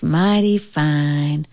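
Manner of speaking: slow, drawn-out contented sigh; lazy, blissful drawl; sighed contentment. A narrator's reading. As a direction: sigh it slowly, well pleased. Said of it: "sighed Dusty Locks".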